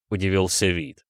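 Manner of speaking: usual speaking voice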